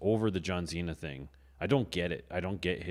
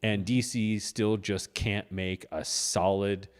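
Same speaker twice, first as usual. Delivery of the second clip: normal talking voice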